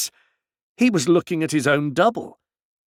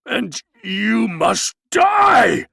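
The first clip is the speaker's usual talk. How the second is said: much higher, louder, slow menacing shout; awful voice; 9 semitones higher, 6 dB louder, much slower than usual. A narrator's reading. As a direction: pronounce the sentence like a terrible verdict. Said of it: "said Maskell in an awful voice".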